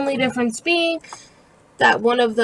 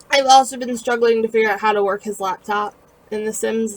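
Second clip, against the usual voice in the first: ordinary talk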